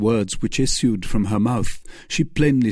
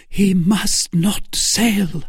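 high, slow, emphatic outcry; Milady crying out; 10 semitones higher, 4 dB louder, much slower than usual. Acted. Milady forgetting herself before Felton, high slow cry. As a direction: lose composure, pitched high and drawn out. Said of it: "cried Milady, forgetting her usual presence of mind".

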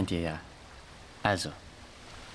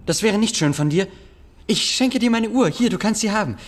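higher and louder, begging; desperate pleading; 8 semitones higher, 5 dB louder than usual. Acted. Boy begging the bully not to report him, desperate and pleading. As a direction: plead frantically to buy him off.

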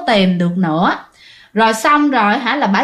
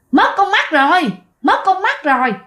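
higher, eerie mock-laughing chant; possessed, laughing taunt; 8 semitones higher than usual. Possessed woman mocking grandmother, higher eerie taunt. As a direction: gloat like a spirit inside her. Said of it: "vừa cười vừa nói".

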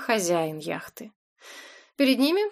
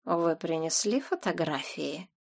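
drawled menace; 4 semitones lower, 6 dB quieter than usual. A narrator's reading. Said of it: "протянул".

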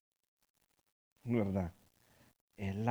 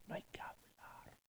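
whisper; 26 dB quieter than usual. Retold or imitated. Family secrets kept hushed.

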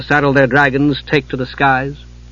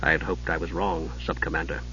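much lower and softer, hushed; quietly; 9 semitones lower, 14 dB quieter than usual. Acted. Steele answering his commander, quiet hushed reply. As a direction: admit it under his breath. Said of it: "he said quietly".

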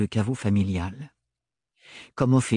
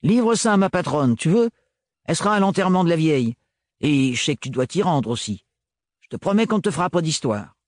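put-on higher, quick, persuasive young mechanic; coaxing Gaëlle; 5 semitones higher, faster than usual. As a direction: rush the favour, promising no trouble. Narrator voices Gaëlle.

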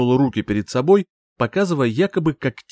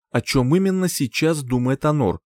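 usual speaking voice

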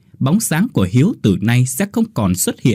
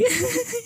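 high breathless burst of laughter; guffaw; 9 dB quieter than usual. Acted. Hiếu laughs at the boy's joke. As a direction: crack up helplessly. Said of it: "Hiếu cũng cười lên sằng sặc".

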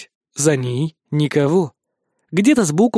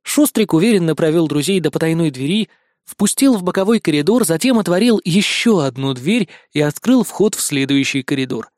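usual speaking voice

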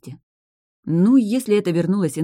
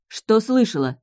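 clipped; 6 semitones higher, slower than usual. Acted. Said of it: "отчеканила Эль".

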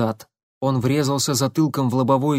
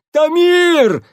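высокий испуганный крик; кричит в панике; вопль ужаса; 8 dB louder than usual. Acted. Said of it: "в ужасе завопил Корнилов". (завопил в ужасе)

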